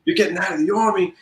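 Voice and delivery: high-pitched, incredulous mock Brooklyn accent. Sergeant major hears soldier is leaving the Army, incredulous mock Brooklyn accent. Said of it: "he's got that deep accent".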